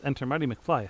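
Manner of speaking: ordinary delivery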